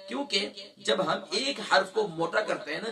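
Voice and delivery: ordinary talk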